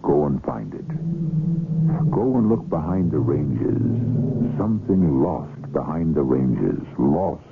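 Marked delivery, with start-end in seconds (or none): none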